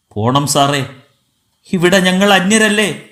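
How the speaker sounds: plain reading voice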